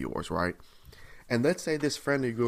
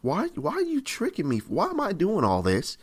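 higher-pitched, bewildered protest; exasperated complaint to God; 4 semitones higher than usual. Acted. Preacher voices Jeremiah questioning God, exasperated and tricked. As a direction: plead with God for an explanation, voice leaping up. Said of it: "This is how Jeremiah feels".

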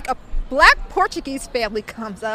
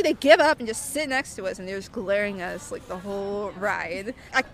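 ordinary talk, nothing marked

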